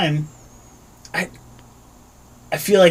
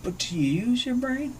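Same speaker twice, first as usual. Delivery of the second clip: soft incredulous; 5 semitones higher, 10 dB quieter than usual. Retold or imitated. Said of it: "And I'm like".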